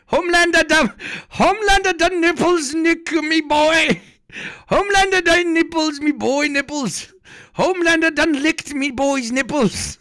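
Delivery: very high, loud mock Aussie voice; squeaky mock Australian. Laughing at the streamer, high mock Australian accent. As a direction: push the Australian accent into a falsetto. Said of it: "I can't do the Australian accent".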